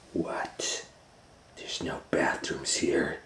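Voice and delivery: low, hushed gorilla; gorilla's whisper. Acted. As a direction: hiss it under the breath.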